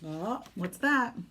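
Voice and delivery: ordinary delivery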